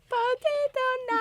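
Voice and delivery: high cartoon babble; Minion voice. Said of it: "What is it?".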